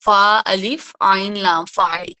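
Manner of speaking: slow letter-by-letter spelling, fa alif ain lam, then فاعل. Shown as a demonstration. Teacher spells Arabic pattern فاعل for class. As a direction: spell فا الف عین لام slowly then say فاعل low. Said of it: "اس کو میں فاعل کے وزن پہ لاؤں".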